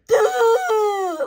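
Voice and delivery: high, loud derisive bellow; mock slow-witted voice. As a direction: imitate her as a long high wail. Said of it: "she always sounds like that".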